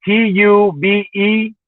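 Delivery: slow careful dictation of letters; slow spelling out. Shown as a demonstration. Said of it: "the dash has to be there".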